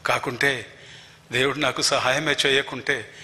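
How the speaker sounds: ordinary talk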